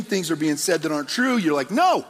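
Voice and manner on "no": sharp, high, loud objection; speaking up for a friend, loud; firm no. Retold or imitated. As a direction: cut them off with a hard no. Objecting to lies about family.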